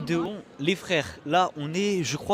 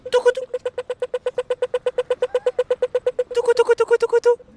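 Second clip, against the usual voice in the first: very high loud sing-song call; playful call; 12 dB louder, much faster than usual. Pretend. The girls walk too far ahead, very high loud calling. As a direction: shout it high like a bird call.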